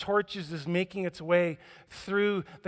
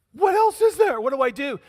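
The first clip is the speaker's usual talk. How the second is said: exasperated plea; 7 semitones higher, 7 dB louder than usual. Retold or imitated. Acted confused believer quote.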